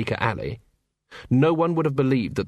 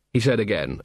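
usual speaking voice

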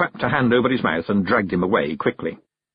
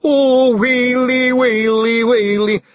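high, loud, drawn-out lament; keen it loudly like a mock mourner; hammed-up sobbing wail; 6 dB louder, much slower than usual. A narrator's reading. Daft Woolly mourns his chief, hammed-up wailing. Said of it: "he sobbed".

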